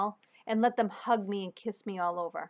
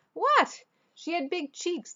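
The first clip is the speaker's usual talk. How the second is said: mock innocence; 10 semitones higher, 4 dB louder, slower than usual. Dad feigning innocence.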